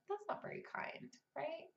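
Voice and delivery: whispered, soft and neutral; gentle whisper. Shown as a demonstration. Writer whispering a gentle neutral rebuke to her critic. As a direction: breathe it gently. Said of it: "gentle, neutral".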